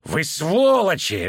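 high, slow snarling shout. A narrator's reading. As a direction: bark the insult. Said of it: "рявкнул Ангус Брикри". (рявкнуть)

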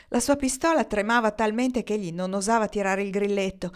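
ordinary delivery

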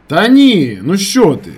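high, loud dismissal; loud reassurance. A narrator's reading. Narrator reassuring his friend by phone, shouting. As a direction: shout away his doubts.

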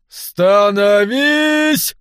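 slow, drawn-out loud command; shouting drill sergeant. A narrator's reading. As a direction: bellow the order long and high. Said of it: "снова проорал Ботрайт".

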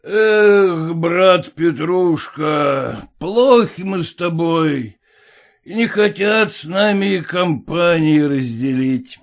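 put-on slow, drunken lament; tipsy old father. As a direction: drawl it like a tipsy merchant.

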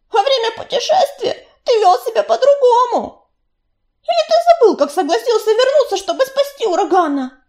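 high, loud, mocking young cat; a young she-cat taunting her friend, half-laughing; bitter reproach. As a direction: needle him with his past. Белочка reproaches him.

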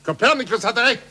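triumphant outburst of discovery; wild excitement. Acted. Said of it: "Beruhige dich".

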